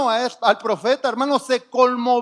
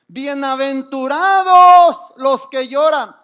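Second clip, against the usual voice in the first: emphatic scripture quote; 2 semitones higher, 9 dB louder, slower than usual. Real, not acted. Preacher quoting scripture with force.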